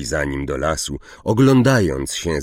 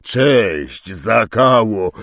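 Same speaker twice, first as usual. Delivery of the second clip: hearty greeting; 4 semitones higher, 6 dB louder, much slower than usual. Acted. Hagrid hails another centaur.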